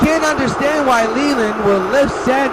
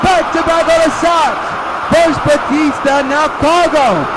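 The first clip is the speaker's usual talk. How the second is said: home run call; 6 semitones higher than usual. Pretend. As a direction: keep the home run excitement going. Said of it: "the home run call".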